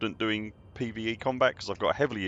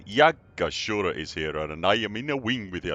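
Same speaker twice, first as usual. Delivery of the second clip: a put-on voice; 5 dB louder than usual. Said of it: "I don't know why I'm using that voice".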